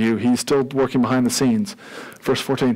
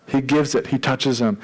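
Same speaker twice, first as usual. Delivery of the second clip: ordinary talk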